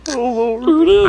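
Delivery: high, pleading mock whine of the arrested man; mocking whimper. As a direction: beg like a caught creep, hammed up. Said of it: "time for the oh lord".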